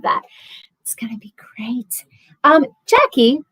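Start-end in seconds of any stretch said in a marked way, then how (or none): 0.8-2.0 whispered, under the breath; self-convincing whisper. Real, not acted. Trying to convince herself about the rafting, whispering. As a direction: whisper it as a reassurance to yourself.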